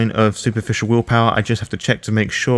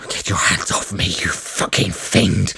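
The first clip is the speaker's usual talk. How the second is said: lower, softer, seething; low contempt; 4 semitones lower, 4 dB quieter than usual. Acted. Rochelle in a full nelson, snarling low at Brian. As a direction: growl it with disgust.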